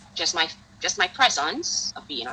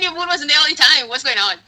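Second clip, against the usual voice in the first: high, louder shocked outburst; half-laughing astonished exclamation; retold shock; 9 semitones higher, 8 dB louder than usual. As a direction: blurt it out in disbelief. Shock at moon in room.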